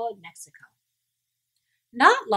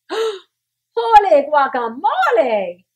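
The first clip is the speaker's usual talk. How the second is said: loud, slow, higher astonished cry; startled shout; 7 semitones higher, 11 dB louder, much slower than usual. A narrator's reading. Kitten shocked by the mirror, loud slow shout. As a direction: draw out the shock. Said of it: "he shouted".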